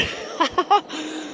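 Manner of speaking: breathless laugh. Real, not acted. Laugh after near spill.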